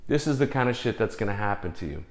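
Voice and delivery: ordinary delivery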